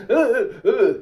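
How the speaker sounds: high, loud stammering through a laugh; laughingly ape a flustered tough guy; mock stammer. Imitating a flustered guy who wanted to fight, laughing. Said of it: "While he's like".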